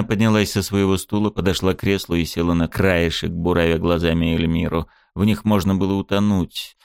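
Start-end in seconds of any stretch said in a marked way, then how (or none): none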